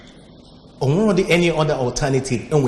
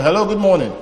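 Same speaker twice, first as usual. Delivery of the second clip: raised, bright phone greeting; calling to a phone caller; 7 semitones higher, 6 dB louder, faster than usual. Real, not acted. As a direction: call out loud down the line.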